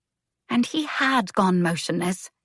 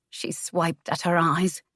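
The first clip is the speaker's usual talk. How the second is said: ordinary delivery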